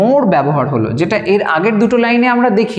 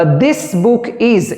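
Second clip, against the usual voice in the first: slow dictation; 2 semitones higher, much slower than usual. Shown as a demonstration. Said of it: "এরমভাবে বলতাম".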